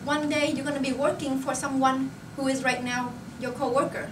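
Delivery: usual speaking voice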